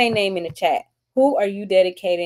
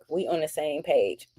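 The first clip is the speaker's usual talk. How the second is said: ordinary talk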